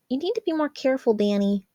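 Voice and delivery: put-on lightly scolding older sister; chiding. A narrator's reading. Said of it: "she chided".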